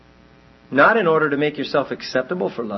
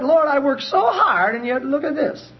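a little upset; 10 semitones higher than usual. Pretend. Believer complaining to God, a little upset high plea. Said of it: "there's a little upset".

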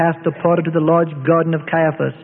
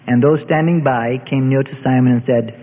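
ordinary delivery, nothing marked

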